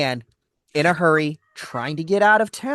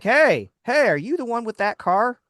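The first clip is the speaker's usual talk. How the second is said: calling out; 7 semitones higher than usual. Retold or imitated. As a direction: hail him from across the lot. Mechanic calling out to customer.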